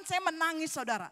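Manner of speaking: ordinary talk, nothing marked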